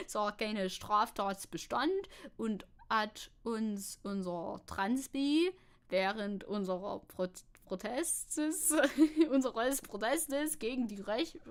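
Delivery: halting mock Saxon German; amateur Saxony accent, amused and unsure; Saxony accent attempt. Shown as a demonstration. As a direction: stumble through it in a put-on Saxon accent. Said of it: "try to read this with a Saxony accent".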